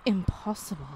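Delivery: plain reading voice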